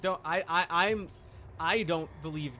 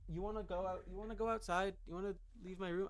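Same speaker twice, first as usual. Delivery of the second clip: higher, softer coaxing voice; pet talk; 4 semitones higher, 8 dB quieter, faster than usual. Real, not acted. Streamer asks his pet to leave his room, higher and softer coaxing. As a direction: sweetly coax the pet to go out.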